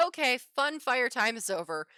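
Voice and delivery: acted brisk command to the group; sudden take-charge urgency. Retold moment of taking charge.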